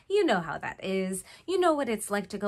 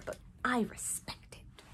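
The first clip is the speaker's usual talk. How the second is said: hushed respectful aside; whisper; 2 semitones higher, 10 dB quieter than usual. Real, not acted. Admitting respect for shoe designers, whispered. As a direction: drop to a whisper. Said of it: "whispered aside".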